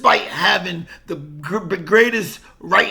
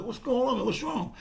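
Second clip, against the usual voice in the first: concerned manager; 5 semitones higher, 8 dB quieter, faster than usual. Retold or imitated. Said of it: "my manager ... like".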